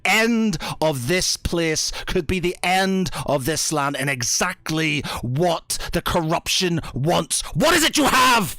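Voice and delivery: paladin's alarm boiling over; urgent warning building to yell. Acted. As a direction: press harder until you yell the question. Paladin insists, rising to outburst.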